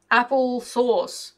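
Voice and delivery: drawn-out booming command to the boars; slow booming command. A narrator's reading. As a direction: call it out loud and slow to the pigs. Commanding the pigs.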